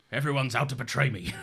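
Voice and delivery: gruff man with a weird Scottish twang; Logan. Retold or imitated. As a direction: put on a rough Scottish-tinged patriarch voice. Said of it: "What is this Logan voice you do?".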